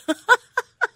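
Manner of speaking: bright high laugh. Real, not acted. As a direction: let a real laugh burst out. Host laughing off emotion.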